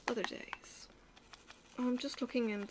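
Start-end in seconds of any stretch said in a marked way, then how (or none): 0.1-0.9 wistful whisper. Real, not acted. Wistful repeat of a phrase.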